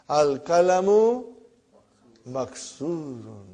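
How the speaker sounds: Al-kalamu maksurun, very slowly, each syllable apart; slow, clear dictation for the class. Shown as a demonstration. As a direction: spell the phrase out slowly for students. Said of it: "What is the meaning now".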